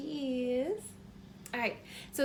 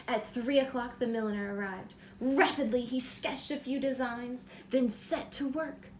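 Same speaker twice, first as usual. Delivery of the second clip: bright, lively storybook reading; reading to children; 4 dB louder than usual. A narrator's reading. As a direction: read it like bedtime story time for a little one. Reads picture book aloud.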